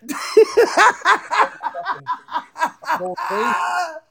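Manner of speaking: long, high cackling laugh; real helpless laughter. Real, not acted. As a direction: crack up completely. Laughing at their own phone mistake.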